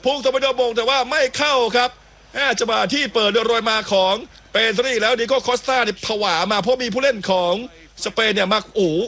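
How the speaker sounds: high-pitched live football call; excited play-by-play. Real, not acted. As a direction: pitch jumps right up for the goalmouth chance. Live goalmouth action.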